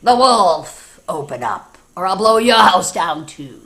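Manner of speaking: loud, booming demand; loud wolf threat. Acted. Wolf threatens the pigs at the brick house, loudly. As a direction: bellow it like a hungry wolf.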